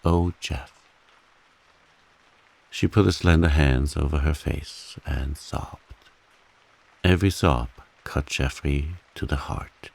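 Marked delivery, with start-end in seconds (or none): none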